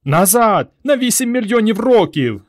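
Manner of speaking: high, loud urgent yell. A narrator's reading. Boy yells to send them back in time, urgent yell. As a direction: bellow the command to escape. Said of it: "заволав Петро".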